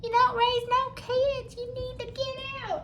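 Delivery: very high, squeaky cartoon nag; nagging bird wife. Bird wife giving her husband a hard time, high nagging squeal. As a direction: screech it like a tiny scolding bird. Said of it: "his little bird wife, and she was like".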